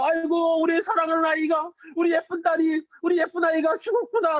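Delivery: storyteller playing mourners weeping over a dead girl, mock wailing. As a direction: cry out like a grieving mourner, hammed up for kids. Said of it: "통곡하면서 엉엉 우는 거예요".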